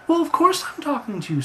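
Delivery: very high, squawky retort; exasperated talking bird. Acted. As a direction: answer impatiently in a high bird voice. Bird answers Roger impatiently.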